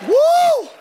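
very high, loud woo; ecstatic whoop. Real, not acted. Preacher rejoicing over washed-away sins, very high whoop. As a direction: throw out a big high shout of joy.

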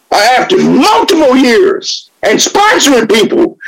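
preaching. Acted. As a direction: hammer the message home like a sermon. Preaches recovery message loudly.

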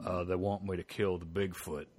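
low-pitched impression of a Texan old-timer; Sam Elliott. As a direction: imitate a deep-voiced, understated Texan. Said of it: "Sam Elliott's like".

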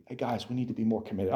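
normal talking voice

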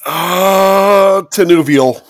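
high, very loud pained guess; desperate guess. Real, not acted. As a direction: blurt it out in frustration. Desperate guess as time runs out.

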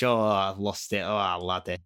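low, mock Scottish accent. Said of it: "You've gone Scottish again".

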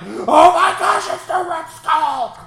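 high, loud, slow squeal; excited gasp. Retold or imitated. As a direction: squeal like a thrilled fan. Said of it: "That's what I did".

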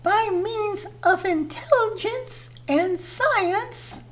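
high, slow airy goddess voice; a serene earth goddess speaking slowly; Mother Nature voice. Acted. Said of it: "Right on, Mother Nature".

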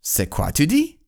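high-pitched puzzled question in French; French-speaking worker. Acted. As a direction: call back up in French, playfully acted. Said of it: "the guy on the ground says".

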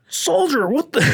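high mock drill sergeant yell; angry drill sergeant. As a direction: imitate the sergeant losing it. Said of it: "And they're like".

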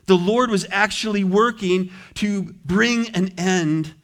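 usual speaking voice

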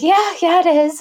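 high-pitched, amused concession; emphatic agreement. Retold or imitated. Patient agreeing with the surgeon's verdict, emphatic agreement. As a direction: agree readily, pitch jumping right up.

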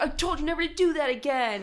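angry man voice. Retold or imitated.

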